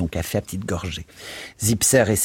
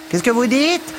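high yell over the vacuum cleaner; shouted; 4 dB louder than usual. A narrator's reading. Charwoman yelling over the vacuum cleaner, very high and loud. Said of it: "hurla Mrs Biggs".

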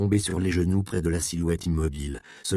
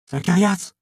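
calling out; 11 semitones higher, 6 dB louder than usual. Acted. Calling through the door.

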